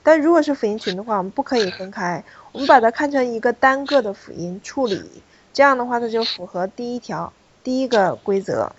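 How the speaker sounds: normal talking voice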